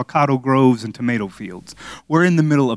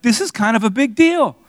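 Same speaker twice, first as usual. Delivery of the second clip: high mock-serious doctor voice; 10 semitones higher than usual. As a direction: play the doctors, pitched up and grave. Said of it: "the doctors are kinda like".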